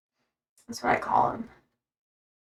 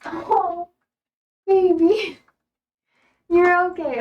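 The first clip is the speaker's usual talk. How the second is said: soothing a pet; 6 semitones higher, slower than usual. Real, not acted. Comforting scared dog.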